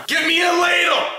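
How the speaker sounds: high, loud demanding cry; mock chef shout. Shouting for a ladle in a frantic cooking rush, high and loud. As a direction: bark the order like a frantic chef.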